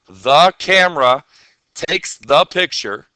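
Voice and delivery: the camera takes the picture, word by word, slow; slow, spelled out. Shown as a demonstration. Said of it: "Say it to yourself".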